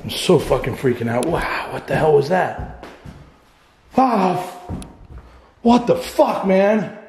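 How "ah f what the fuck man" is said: startled fear. Real, not acted. Startled by something in house.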